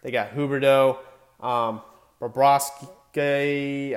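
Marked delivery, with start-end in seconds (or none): 2.2-4.0 Bobrovsky with a long, drawn-out ending; unsure pronunciation. Real, not acted. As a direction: stretch out the end of Bobrovsky, unsure of it. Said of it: "I think that's how you say his name".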